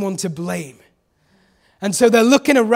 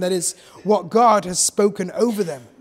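ordinary talk, nothing marked

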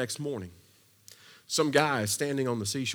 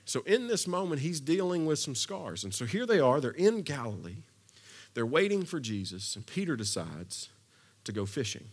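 normal talking voice

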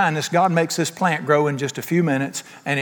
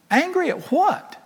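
much higher, slow disbelieving question; incredulous question; 12 semitones higher, slower than usual. Real, not acted. Preacher asks the congregation why the brother is angry, slow incredulous. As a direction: stretch it out in mock disbelief.

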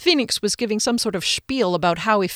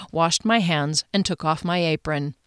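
usual speaking voice

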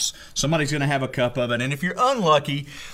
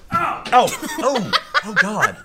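high alarmed gasp of shock; shocked outburst; 14 semitones higher, 4 dB louder, much slower than usual. Real, not acted. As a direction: yelp as something goes wrong. Cookie thrown hits Jack.

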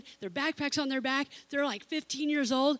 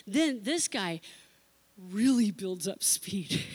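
ordinary talk, nothing marked

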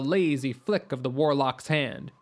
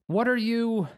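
flustered; 7 semitones higher than usual. Acted. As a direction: caught off guard, pitch jumping up.

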